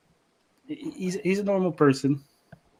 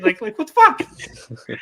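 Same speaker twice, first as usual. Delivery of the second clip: incredulous outburst; 12 semitones higher, 9 dB louder, much slower than usual. Real, not acted. Incredulous at dad's reasoning.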